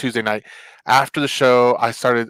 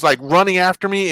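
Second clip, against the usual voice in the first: usual speaking voice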